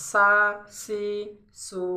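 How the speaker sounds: সা, সি, সু as separate syllables, each starting on a plain s; sin pronunciation. Shown as a demonstration. Teacher asking learners to copy, clear s on each syllable. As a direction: model সা, সি, সু with a clean s. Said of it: "পড়তেছি সেরকম".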